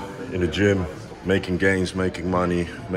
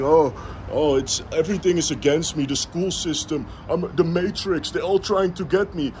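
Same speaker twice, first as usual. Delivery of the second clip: higher, mocking whiny complainer; mock whiner; 9 semitones higher than usual. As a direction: parody a lazy moaner. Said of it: "complaining little bitch that is only, like, talking".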